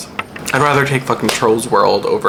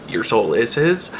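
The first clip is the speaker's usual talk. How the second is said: ordinary delivery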